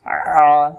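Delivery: আ squeezed tight in the throat and held; pressed throat Ain. Shown as a demonstration. Teacher corrects the Hamza slip, throat-pressed আ. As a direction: press mid-throat while sounding a long আ. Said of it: "গলা চাপ দিতে হবে".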